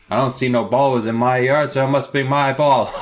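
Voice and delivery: lightly voiced gloating neighbour; smug neighbour. Neighbour keeping the kids' ball, smug and laughing. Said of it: "He's like".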